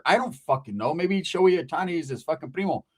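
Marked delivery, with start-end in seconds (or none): none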